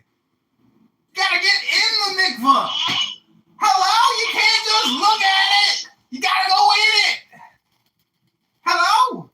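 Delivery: shouting. Acted. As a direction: yell it like a lecture. Mock scolding rant.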